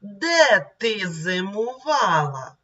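slow, syllable by syllable reading. Shown as a demonstration. Teacher rereading the poem slowly for pupils to repeat. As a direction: read it slowly so children follow. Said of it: "І ще раз давайте прочитаємо".

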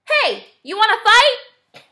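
high, loud picking a fight; cocky little bug calling out; grouchy ladybug. A narrator's reading. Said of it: "She said to the flipper".

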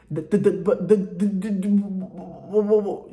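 mimicked stutter stuck on "the" and "whoa"; imitated stutter. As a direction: stammer rapidly on the first sound, getting nowhere. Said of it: "he had a speech impediment".

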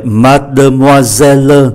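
"mademoiselle" with middle and final e voiced; five syllables. Shown as a demonstration. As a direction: say all five beats of "mademoiselle". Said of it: "năm syllab, còn lại ba". ("mademoiselle" đọc đủ năm âm tiết)

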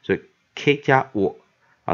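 ordinary delivery

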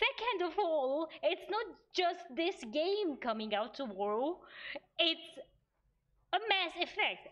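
theatrical indignation, pitch leaping up; mock outrage. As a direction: protest in hammed-up disbelief, punching key words. Mock outrage at friend's remark about game.

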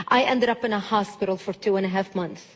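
usual speaking voice

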